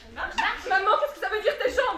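high, loud disbelief; astonished. Acted. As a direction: gasp out the question. Shocked discovery, high and loud.

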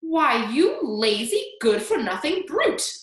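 loud, high, scolding outburst; outraged aunt berating a child; angry shout. A narrator's reading. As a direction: shout the insults with relish. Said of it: "Aunt Spiker shouted".

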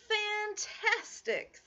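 high, slow, drawn-out fantastic; mock amazement. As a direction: gush over the dragon. Elizabeth praises dragon.

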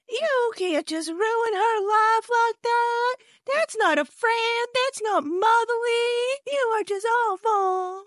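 put-on high, slow, wailing older lady; mock tearful scolding. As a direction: draw out the reproach in a mock-weepy high voice. Mimics Miss Debbie fighting backstage.

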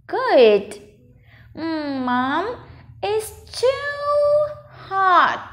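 very slow, high, drawn-out "too"; slow model reading. Shown as a demonstration. Teacher reading the whole sentence for a child, slow and drawn out. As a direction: stretch "it's too hot" slowly, pitched high. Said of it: "mình đọc cả câu nhá".

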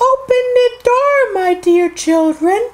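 very high, put-on sing-song mother voice; wolf posing as mother. A narrator's reading. Wolf at the door to the seven kids, high pretend-mother voice. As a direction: coax in a fake high mother's voice. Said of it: "he said".

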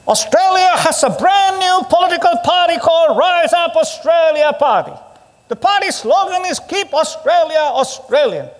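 hammed-up announcer pitching the story; mimicking the SBS reporter. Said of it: "And they said this".

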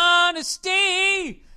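big high shout of honesty; a sudden loud cry. Real, not acted. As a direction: bellow the word out. Shouted punchline.

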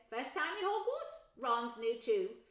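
high, loud, slowing mum; chatty mother. Acted. Mother telling Harry about Ron, high and chatty. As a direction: chat him up kindly.

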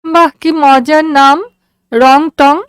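put-on a sick girl amused by a funny name; delighted daughter. A narrator's reading. Daughter to father about the station name, high and delighted.

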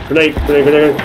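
loud, high repeated grenade call; grenade warning yell. Real, not acted. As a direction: shout it out to the squad. Calling out grenade throw.

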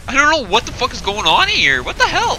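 high, loud panicked yelling; scared. Real, not acted. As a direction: yelp it out in fright. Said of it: "I fucking scared".

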